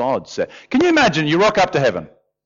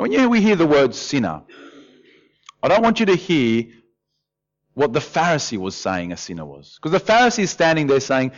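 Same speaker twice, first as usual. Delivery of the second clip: ordinary delivery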